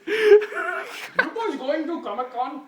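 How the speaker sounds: high, slow, laughing through the words; laughing driver voice. Acted. Imagined taxi driver asks the heroes, high and laughing. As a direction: play the cabbie while losing it.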